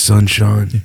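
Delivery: a light take on a slow British gent; William Regal impression. Host lightly mimics William Regal saying Sunshine. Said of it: "He's a little more like this".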